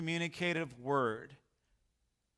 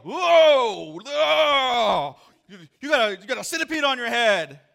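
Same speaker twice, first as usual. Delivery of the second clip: high, loud startled yell; alarmed shout; 9 semitones higher, 13 dB louder than usual. Retold or imitated. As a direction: shout a warning in shock. Sees centipede on man's head.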